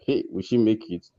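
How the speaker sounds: normal talking voice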